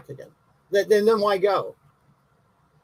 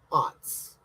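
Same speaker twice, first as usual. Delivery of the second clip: voiceless whisper of the word Aughts; hushed conspiratorial Aughts; 6 dB quieter than usual. Acted. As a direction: drop Aughts to a secretive whisper. Repeats the same word as a whisper.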